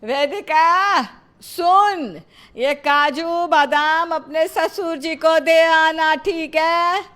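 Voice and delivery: loud, slow and deliberate; speaking up. Acted. Mother-in-law to hard-of-hearing bahu, loud and slow. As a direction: spell it out loudly for someone hard of hearing. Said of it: "आप थोड़ा ऊंचा बोलिए ना".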